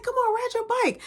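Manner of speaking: put-on high, pushy kid voice; little brother pleading.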